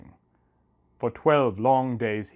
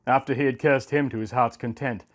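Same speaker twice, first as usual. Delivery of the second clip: plain reading voice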